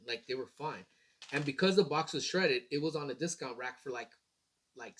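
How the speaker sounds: ordinary talk, nothing marked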